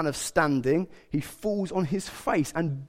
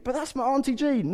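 higher-pitched, pleading objection; mock protest; 8 semitones higher than usual. Relative turned away at the cordon, mock protesting. Acted protest at being kept out.

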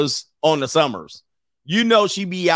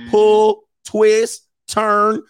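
mock game commands; 6 semitones higher, 5 dB louder, much slower than usual. Bop It commands.

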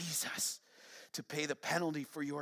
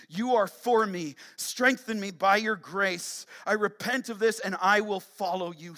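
loud, emphatic sermon appeal; fervent preaching; 6 semitones higher, 10 dB louder than usual. Real, not acted. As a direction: preach it with force, phrase by phrase. Preacher urging a declaration.

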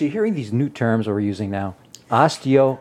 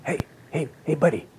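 hushed, sneaky dealer pitch; shady street dealer. Pretend. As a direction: lure him in like a back-alley seller. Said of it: "you gotta give it a little bit of deeper".

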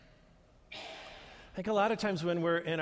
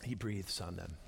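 hushed, low; a gentle breath of a line; soft breathy hush; 10 semitones lower, 8 dB quieter than usual. Shown as a demonstration. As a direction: say it softly, almost breathing it. Quiet breath demonstration.

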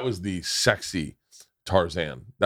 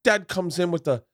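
higher-pitched imitation Cockney; London gangster accent; 8 semitones higher than usual. Comedian imitates dude bragging about gangster dad, mock Cockney accent. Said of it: "I can't do the accent".

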